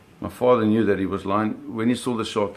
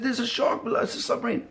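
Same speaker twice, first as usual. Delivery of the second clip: put-on high, breathless boy's voice; excited kid; 14 semitones higher than usual. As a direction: blurt it out to dad, wide-eyed. Retold boy shouting to dad.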